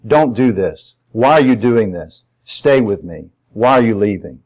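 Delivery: ordinary talk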